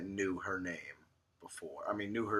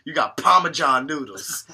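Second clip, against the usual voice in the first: high, loud put-on voice, slowed at the end; mocking a clueless diner ordering; imitating a customer; 9 semitones higher, 12 dB louder than usual. Mock diner voice, high and loud, to the waiter. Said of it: "She's like".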